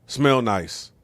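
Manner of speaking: normal talking voice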